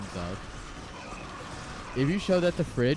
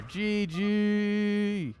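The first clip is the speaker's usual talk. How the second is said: held-out call; 8 semitones higher than usual. Real, not acted. Caster calls end of series.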